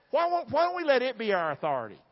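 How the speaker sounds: ordinary talk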